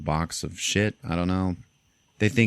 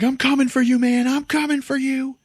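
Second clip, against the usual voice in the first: very high, loud hammed-up yell; shrieked threat across the room; mock enraged threat; 4 dB louder than usual. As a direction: imitate a wound-up man screaming revenge. Taunted man shouts a threat.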